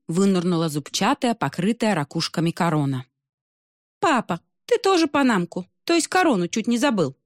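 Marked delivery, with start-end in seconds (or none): 4.0-7.2 teasing daughter. Acted. Mermaid teasing her father.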